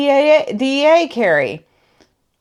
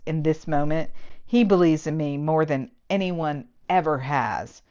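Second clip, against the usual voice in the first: lower, softer reading voice; book reading; 6 semitones lower, 5 dB quieter than usual. Acted. Reviewer reading the romance novel excerpt aloud, soft and low. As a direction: read the passage quietly, like the novel's narrator.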